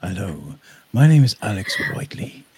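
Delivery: mock smooth narrator voice, breathy near-whisper; imitating Colin's narrator voice. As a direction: put on a rich announcer voice as a joke. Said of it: "That was my first attempt".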